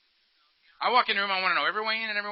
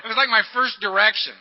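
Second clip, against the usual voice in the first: usual speaking voice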